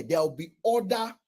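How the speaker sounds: usual speaking voice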